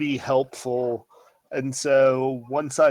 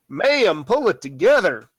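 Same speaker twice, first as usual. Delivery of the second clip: higher, louder in-character urging; urgent character voice; 6 semitones higher, 5 dB louder than usual. Acted. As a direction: call out to bring her to her senses. In-character urging to restrained woman.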